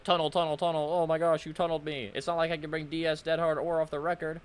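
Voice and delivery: high mocking whine of the opponent; mocking whiny voice. Streamer mocking the opponent's complaints in a high whine. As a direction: mimic him like a whiny kid. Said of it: "he was just like".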